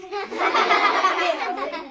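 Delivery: high giggling class laughter; a burst of kids' laughter; children laughing. A narrator's reading. Classmates laughing at Miranda's answer, giggling.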